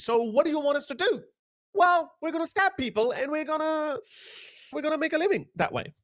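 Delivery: high, mocking voice of the migrant; lightly voiced mocking migrant. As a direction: sneer it out as the migrant. Voicing migrant's reply mockingly.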